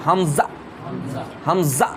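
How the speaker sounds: হামজা started with a quick throat catch; hamza with a catch. Shown as a demonstration. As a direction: hit হামজা with a hiccup-like stop in the throat. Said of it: "এরকম হিচকা মেরে হামজা".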